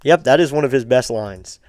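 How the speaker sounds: normal talking voice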